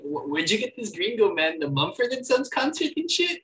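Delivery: a loose imitation of a Mexican accent. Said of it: "Jonah Hill doing, like, a Mexican accent".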